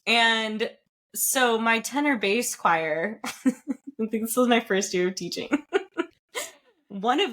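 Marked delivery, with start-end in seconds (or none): none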